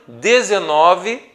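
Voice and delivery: ordinary delivery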